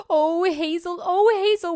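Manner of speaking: high, loud anxious wailing; distressed wail. Acted. Frightened pigs reach sister.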